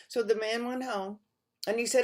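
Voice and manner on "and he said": ordinary delivery, nothing marked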